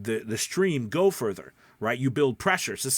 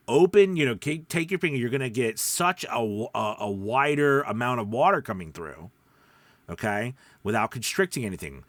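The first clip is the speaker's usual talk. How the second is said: ordinary talk, nothing marked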